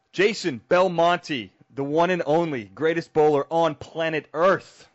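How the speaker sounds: slow, grand ring-announcer build-up; hype announcer intro. Acted. Host introducing his champion guest, announcer-style.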